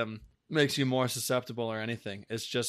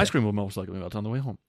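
fast disclaimer read; 2 semitones lower, 5 dB louder, much faster than usual. Pretend. Reading mock fine print caption.

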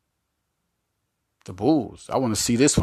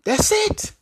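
1 excited. Real, not acted. Emphatic close to Harden quote.

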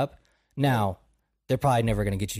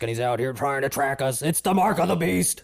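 mock alarmist; 2 semitones higher than usual. Conspiracy theorist warning of Gates tracking us, mock alarm. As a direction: cry it out like a panicked conspiracy nut.

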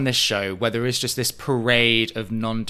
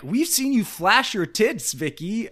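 higher, slow teasing jab; mock host jab; 10 semitones higher, slower than usual. Co-host imitates the host calling Vicky out, slow teasing jab. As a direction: draw it out to catch her out. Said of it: "Andy immediately is like".

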